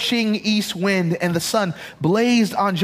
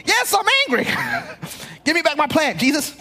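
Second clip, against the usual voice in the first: much higher mock tantrum with a laugh; tantrum Jonah; 10 semitones higher, faster than usual. Pastor imitating Jonah demanding his plant back, high whiny tantrum. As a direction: whine like a spoiled child.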